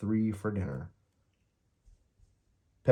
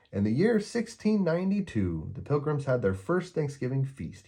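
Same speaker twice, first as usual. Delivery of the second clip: a hint of a higher boy's voice starting a solemn tale; Linus beginning a speech; 6 semitones higher than usual. A narrator's reading. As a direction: begin the history lesson like a keen schoolboy. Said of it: "he begins".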